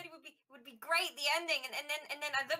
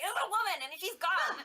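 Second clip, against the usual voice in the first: raised, urgent announcement; urgent in-character cry; 7 semitones higher, 4 dB louder than usual. Acted. Telling Sel to his face, urgent and raised. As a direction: blurt out the news right in his face.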